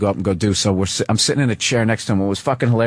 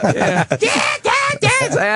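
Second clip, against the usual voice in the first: imitated high-pitched pig squeals; mock pig shrieks, way up high. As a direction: squeal like a pig in short bursts. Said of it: "the noise a pig makes".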